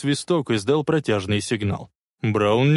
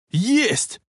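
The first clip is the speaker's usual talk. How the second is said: high whoop of triumph; joyful yell; 10 semitones higher than usual. A narrator's reading. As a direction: yell with delight. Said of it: "радостно завопил Марти". (радостный вопль)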